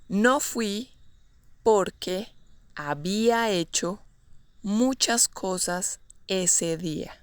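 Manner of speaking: word groups set apart by pauses. Shown as a demonstration. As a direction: give it chunk by chunk, unhurried. Teacher models example slowly, then naturally.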